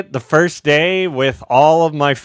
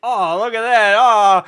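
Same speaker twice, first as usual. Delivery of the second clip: pleasantly surprised fan; 9 semitones higher, 5 dB louder than usual. Retold or imitated. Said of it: "you're like".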